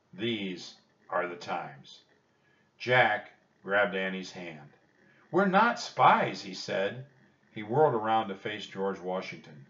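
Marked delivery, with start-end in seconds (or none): 5.3-6.4 loud, high, drawn-out denial; urgent protest. A narrator's reading. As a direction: protest slowly and forcefully. Jack protests the major's accusation.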